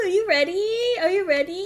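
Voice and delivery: excited hyping. Real, not acted. Streamer asking are you ready, excited hyping. Hyping up before next scene.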